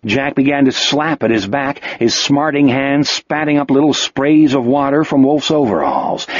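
plain reading voice

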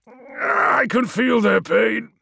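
slow, high cry of pain; strained, anguished wail; agonised. Acted. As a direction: drag it out in torment. Magnus cries out in torment.

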